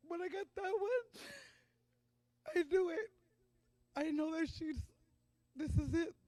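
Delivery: high, breaking voice fighting back tears; talking through real tears, voice cracking high; real crying. Real, not acted. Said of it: "probably like I'm crying right now".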